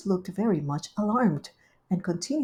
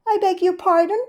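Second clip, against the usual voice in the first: much higher, louder gushing hostess; Rivy's voice; 12 semitones higher, 7 dB louder than usual. Acted. Rivy welcoming Duchess, high gushing hostess. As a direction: greet her sweetly and grandly.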